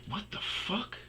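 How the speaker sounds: high-pitched imitation of an offended ex; re-enacted indignant reaction; mock outrage. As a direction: squeal it in affronted disbelief. Ex's offended reaction, retold.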